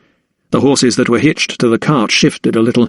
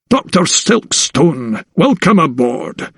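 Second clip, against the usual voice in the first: slow, affable Scottish captain's welcome; Scottish sea captain; 5 semitones higher, slower than usual. Acted. Sea captain welcoming the doctor aboard, slow Scottish warmth. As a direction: greet him like a weathered Scots skipper.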